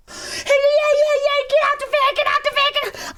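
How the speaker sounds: high, loud frantic pleading; panicked scream. Retold or imitated. The beaten attacker screaming to get out, a high panicked shriek. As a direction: scream to be let go.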